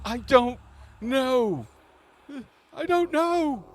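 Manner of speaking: frightened human prisoner pleading; begging prisoner. Acted. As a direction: beg for his life in a squeaky voice. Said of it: "He's like".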